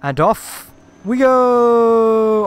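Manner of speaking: long, slow, high whoop; drawn-out excited cheer. Real, not acted. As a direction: stretch it out like a launch. Takes off in gyrocopter.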